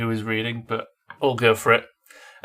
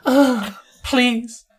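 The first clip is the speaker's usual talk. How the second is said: high, laughing mock plea; mock groan; 12 semitones higher, 4 dB louder than usual. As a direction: ham up a breathless please. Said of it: "I groan".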